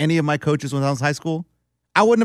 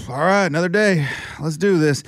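unenthused. Retold or imitated. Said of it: "They walk into work the way we do".

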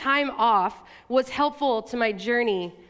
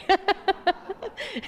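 warm laugh; 8 semitones higher than usual. Real, not acted. Congregation laughs at shared memory.

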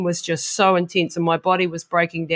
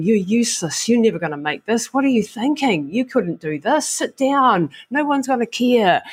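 a hint of a jeering, rising inner critic, higher near the end; sneering inner snake; 5 semitones higher than usual. Runner voicing the snake that tells her to quit, lightly sneering. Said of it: "the snake on the other side going".

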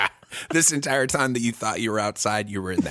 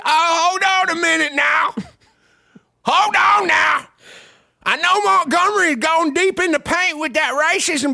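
a loud, much higher hammed-up Southern protest; a hammed-up Southern shout, much higher and louder; indignant Southerner; 14 semitones higher, 7 dB louder than usual. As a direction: shout it like an offended Alabama local.

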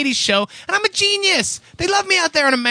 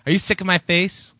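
normal talking voice

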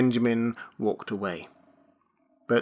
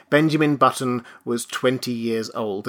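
usual speaking voice

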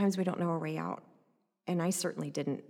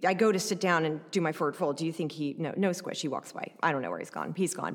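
ordinary delivery

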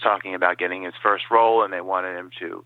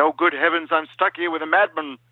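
higher, fussy Doctor Smith in mock horror; Doctor Smith impression; 8 semitones higher than usual. Smith stuck on a planet with Major West, in high fussy mock horror. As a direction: wail it like a pampered coward stranded. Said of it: "he said".

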